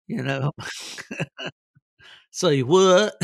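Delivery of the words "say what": a puzzled, louder, higher say what; loud baffled say what. Retold or imitated. Puzzled listener blankly asking the storyteller to repeat, loud. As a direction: act baffled by the joke. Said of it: "other people just look at me and say".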